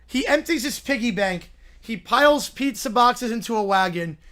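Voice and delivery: normal talking voice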